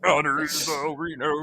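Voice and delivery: Kermit voice. Retold or imitated. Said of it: "Kermit voiced [name]".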